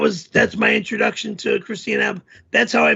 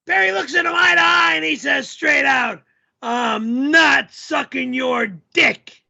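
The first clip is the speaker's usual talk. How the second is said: hammed-up yelling character, pitched up; 3 semitones higher than usual. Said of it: "the guy yells all the time".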